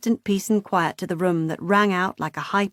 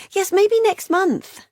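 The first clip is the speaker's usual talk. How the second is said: high vague sister voice; 13 semitones higher, 4 dB louder, slower than usual. A narrator's reading. Beth's vague phone reply.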